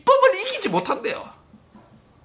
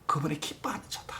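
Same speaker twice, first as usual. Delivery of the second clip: whispered; 8 semitones lower, 13 dB quieter than usual. Acted. Whispered truth.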